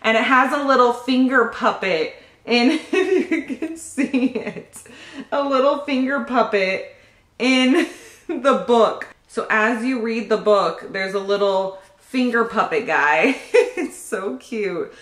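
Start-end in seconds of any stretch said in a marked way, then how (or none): 2.9-9.1 giggling through the words; laughing. Real, not acted. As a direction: laugh while showing it off. Giggling over finger puppet book.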